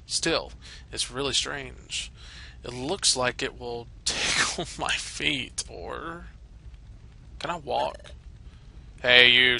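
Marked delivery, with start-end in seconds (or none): none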